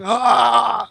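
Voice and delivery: drawn-out high "ah"; long high-pitched cry, voice jumping up; imitated cry. Speaker imitates someone crying out, high drawn-out "ah".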